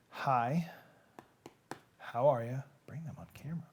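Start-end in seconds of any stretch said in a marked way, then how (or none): 2.9-3.6 whispered aside. Real, not acted. Host whispering to the producer during the live stream. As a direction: whisper it so the viewers can't hear.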